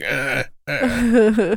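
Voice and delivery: high strained monster grunt; creature grunt. Retold or imitated.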